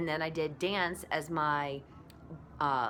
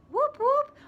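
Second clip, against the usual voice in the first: high, louder cheering whoop whoop; celebratory whoop; 7 dB louder than usual. Real, not acted. Blogger cheering her comeback, excited whoop. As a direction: whoop it up in praise.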